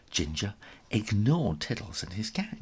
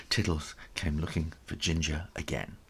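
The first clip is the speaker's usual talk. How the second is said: usual speaking voice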